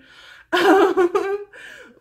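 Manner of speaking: high loud cackle; loud laugh. Real, not acted. After her jab at Becca, loud laughing. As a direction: burst out laughing.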